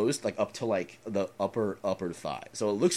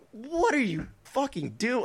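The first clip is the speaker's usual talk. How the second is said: angry yell; 8 dB louder, slower than usual. Retold or imitated. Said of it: "He's like".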